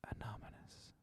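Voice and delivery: faint whispered ghost voice; ghostly whisper. Retold or imitated. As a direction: barely breathe the word. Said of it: "you hear very slightly".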